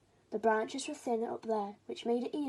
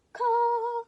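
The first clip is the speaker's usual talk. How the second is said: very high, loud crow caw; demonstration caw; 13 semitones higher, 10 dB louder than usual. Shown as a demonstration. Mother showing baby how to caw. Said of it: "sing like this".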